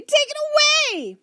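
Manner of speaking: put-on high, loud girl's squeal. A narrator's reading. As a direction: squeal to get rid of it. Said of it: "squealed Sally".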